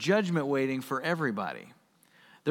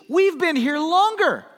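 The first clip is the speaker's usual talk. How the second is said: high, loud complaining laborer; whiny grumbling worker; 11 semitones higher, 7 dB louder than usual. Acted. First-hired workers grumbling to master, whiny high complaint. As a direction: moan about the hot sun.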